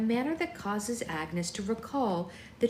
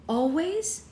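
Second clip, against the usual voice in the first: higher, rising disbelief; surprised; 8 semitones higher than usual. Acted. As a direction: echo it in surprise. Bella's surprised echo.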